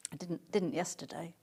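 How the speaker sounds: breathy whispered correction; a quick private whisper to viewers; whispered aside. Real, not acted. Quiet aside about garden.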